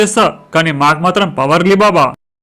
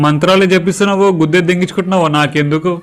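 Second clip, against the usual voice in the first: ordinary delivery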